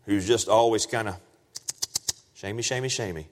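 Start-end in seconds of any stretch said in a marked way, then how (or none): 2.3-3.3 soft, meek little murmur; mock milquetoast. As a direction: mimic a timid, harmless milquetoast, very quietly. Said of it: "is a milquetoast Jesus".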